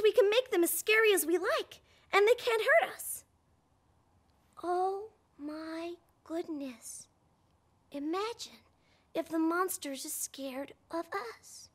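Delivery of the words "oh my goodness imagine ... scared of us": soft, slow, hushed realization; hushed wonder. Acted. Host telling the child a secret idea, hushed wonder. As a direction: lean in and share a secret.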